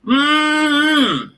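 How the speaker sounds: high, long closed-mouth squeal of frustration; pent-up, drawn-out "mmm"; frustrated groan. Real, not acted. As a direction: strain to hold the anger in. Holding back before he snaps.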